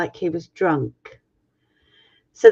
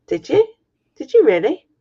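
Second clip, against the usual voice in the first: much higher, lightly voiced confused son; bewildered son; 6 semitones higher than usual. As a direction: ask it like he truly forgot. Son can't remember being told.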